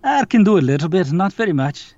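lightly attempted Scottish accent; Scottish brogue. Shown as a demonstration. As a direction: try a bit of a Scottish brogue. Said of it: "can you do a Scottish brogue".

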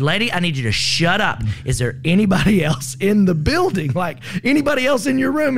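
urgent command. Retold or imitated. Urgent command during fire.

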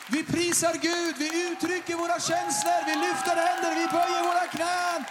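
high, shouted, fervent proclamation; preaching at full force. Acted. Preacher calling the church to praise God, shouting. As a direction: cry it out to the whole church.

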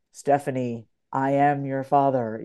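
deep, low fatherly voice; a grave father speaking down. Retold or imitated. Inner voice speaking to Stephanie as her father, deep and grave. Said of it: "that bold voice inside of you that's like".

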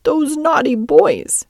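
put-on high, slow, tearful little-girl voice; acted child sobbing. A narrator's reading. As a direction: wail it out like an upset child. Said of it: "she sobbed".

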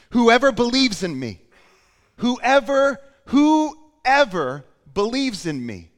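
loud, high shout with urgent passion; crying out. Retold or imitated. Preacher voicing Jesus' final appeal, shouting with passion. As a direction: shout it as an urgent public appeal, stretching the repeats. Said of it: "He shouted".

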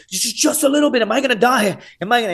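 high, stammering panic; panicked pleading. Acted. Poisoned contestant answering the medic, acting out high-pitched panic. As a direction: act out a high-pitched frantic plea.

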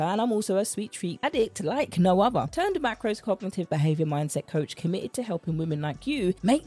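smooth promo host voice; podcast intro read. Acted. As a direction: sell the show like a trailer voiceover. Podcast intro over music.

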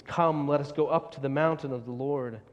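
ordinary talk

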